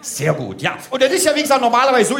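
normal talking voice

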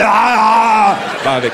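a yelped ah, ah as he bolts; mock startled yelp. Comic telling the audience how he fled, mock yelp. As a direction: play the fleeing dog's yelp, hammed up. Stand-up, retold panicked cry.

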